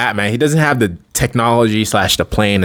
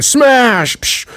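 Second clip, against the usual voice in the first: much higher, punchy sound-effect shout; smash; 12 semitones higher than usual. Retold or imitated. Giant Man bursting out of the prison, shouted smash. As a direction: blurt it like a comic-book impact.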